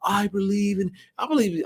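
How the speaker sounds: high mocking poster voice; mock believer. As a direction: sneer at fake belief in a high voice. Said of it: "then you just post".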